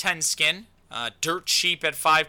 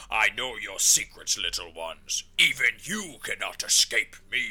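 Mordekaiser; 5 semitones lower than usual. Acted. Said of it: "I voiced Mordekaiser".

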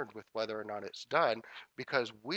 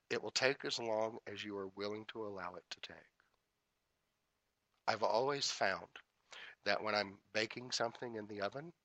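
normal talking voice

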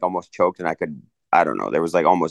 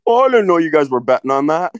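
spacey hippie voice; 9 semitones higher, 4 dB louder than usual. Retold or imitated. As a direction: play the clueless old hippie. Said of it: "I'm like".

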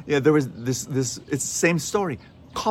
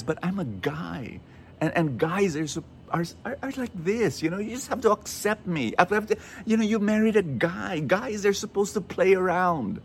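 higher-pitched, lightly acted excuse-making; self-justifying husband; 8 semitones higher than usual. Cheating husband excusing himself to his wife, self-justifying.

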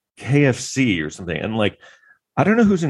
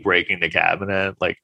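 ordinary delivery

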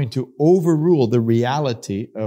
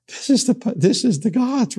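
much higher, amazed; mock awestruck crowd; 9 semitones higher than usual. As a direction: voice the crowd in awe. Crowd thinking gods came.

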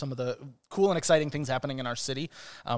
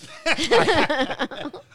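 loud burst of laughter; 13 semitones higher, 8 dB louder than usual. Real, not acted. Laughs after being teased.

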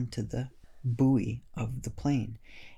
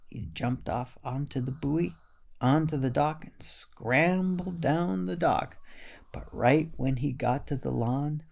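ordinary delivery

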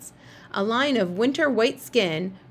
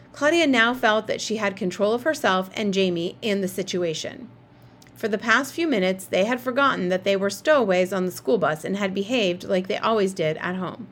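ordinary delivery, nothing marked

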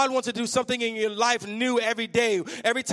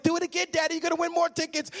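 eager daughter; 6 semitones higher, faster than usual. Retold or imitated. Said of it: "my daughter's like".

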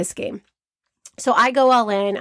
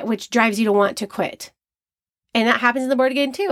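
normal talking voice

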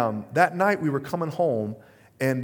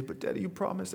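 put-on tearful little girl; 6 semitones higher, 7 dB quieter than usual. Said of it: "she starts to kinda well up in tears".

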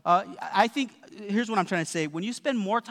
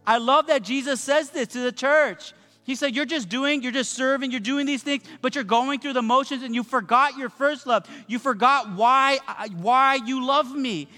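raised, urgent pulpit voice pressing the congregation; full-force preaching; 5 semitones higher than usual. Acted. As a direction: preach it higher and harder to the church.

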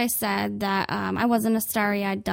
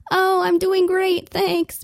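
high, louder forced-cheery reply; fake cheerful; 8 semitones higher, 5 dB louder, slower than usual. Sweating customer answering the clerk, high and fake cheerful. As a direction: cover the panic with a bright fake smile. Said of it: "And you're like".

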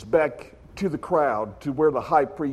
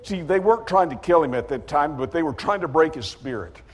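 ordinary talk

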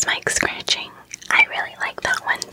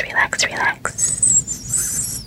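slow soothing whisper; 2 semitones higher, much slower than usual. Real, not acted. Soothing repetition of relax.

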